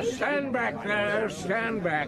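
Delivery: commanding call over the crowd. Acted. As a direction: order the crowd back. Chief pushing through crowd.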